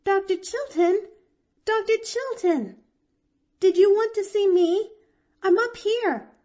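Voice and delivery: high, loud delighted call from a window. A narrator's reading. Girl delightedly calling down from the window to the doctor, high and loud. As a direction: call down gleefully to a friend below. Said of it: "Delightedly, she leaned forward".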